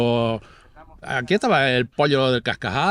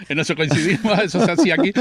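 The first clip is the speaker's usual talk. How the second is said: loud, higher-pitched, laughing recognition; laughing agreement; 6 semitones higher, 6 dB louder than usual. Real, not acted. Neighbour finding a shared old custom with the guest, laughing loudly. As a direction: agree heartily through real laughter.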